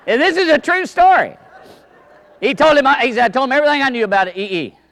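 eager teller hurrying the joke along; excited storytelling. Real, not acted. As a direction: build toward the punchline. Storyteller nearing the punchline.

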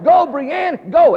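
high, loud cheering on; urging shout. Retold or imitated. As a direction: shout her off like an eager dad. Father urging child on bike.

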